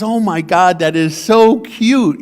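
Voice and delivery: put-on delighted old woman. Elderly shopper laughs at his request to ride in her cart, mock gushing. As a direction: coo it like a charmed grandmother. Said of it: "and she says".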